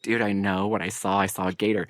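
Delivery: a hint of an insistent neighbour; imitating Missus Biggins. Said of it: "Missus Biggins is like".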